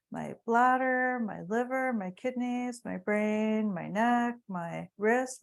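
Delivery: loud, ringing devotional recitation; fervent affirmation prayer. Acted. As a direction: proclaim each line like a heartfelt prayer. Leads healing affirmations aloud.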